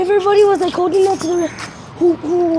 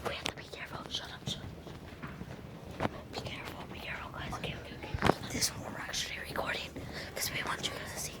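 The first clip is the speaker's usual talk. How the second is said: hushed, urgent warning whisper; real secretive whisper to camera; 21 dB quieter, much faster than usual. Real, not acted. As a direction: whisper so nobody catches you. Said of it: "we're just whispering".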